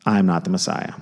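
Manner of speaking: low, soft, settled; quiet confession. Shown as a demonstration. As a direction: confess it gently. Answer said as confession.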